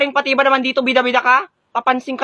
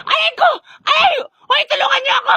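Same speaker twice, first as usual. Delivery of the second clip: panicked cry; 12 semitones higher than usual. Acted. Being beaten, calls help.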